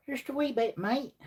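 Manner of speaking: high, playful Aussie "Just a wee bit, mate"; mock Australian accent. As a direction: toss it off like a cheeky Australian. Repeats in Aussie voice.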